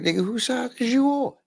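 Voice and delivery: high-pitched retold challenge; indignant challenge. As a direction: imitate someone taken aback, pitched high.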